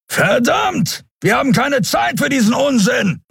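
high, urgent call; impatient shout. Acted. Keru calls to the kissing pair, impatient shout. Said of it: "rief Keru".

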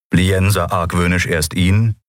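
plain reading voice

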